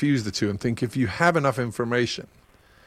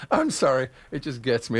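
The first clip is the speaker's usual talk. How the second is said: ordinary talk, nothing marked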